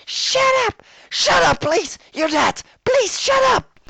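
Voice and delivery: shrieking high and loud in panic; terrified screaming. A narrator's reading. Rarity screams at Pinkie's voice, shrill terrified shrieks. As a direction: scream at the voice to stop. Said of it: "I heard Rarity screaming".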